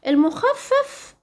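المخفف chanted high and slow; recited term. Shown as a demonstration.

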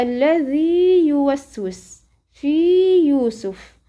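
يوسوس recited slowly with clear long u; slow Quran recitation. Shown as a demonstration. Teacher recites verse examples of مد التمكين. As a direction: recite الذي يوسوس slowly, holding each madd. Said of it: "أيضًا إيه؟".

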